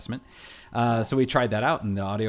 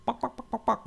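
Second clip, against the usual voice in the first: soft, high clucks; quick little high-pitched bok-boks; chicken clucking; 12 semitones higher, 4 dB quieter than usual. Retold or imitated. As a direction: imitate a kid doing a chicken. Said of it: "what sound does a chicken make".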